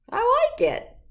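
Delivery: high, loud, thrilled; delighted. Acted. Octopus telling Arlo it loves his drawing. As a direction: squeal it with delight.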